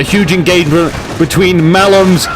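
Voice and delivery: emphatic drawn-out build-up; excited commentary. Real, not acted. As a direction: announce the big clash with rising hype. Excited live cast of engagement.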